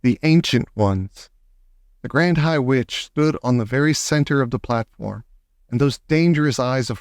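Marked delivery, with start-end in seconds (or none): 0.0-1.3 slow chapter heading; chapter title. A narrator's reading. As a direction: read the title slowly. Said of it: "chapter title".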